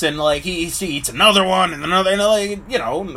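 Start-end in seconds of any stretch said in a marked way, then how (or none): none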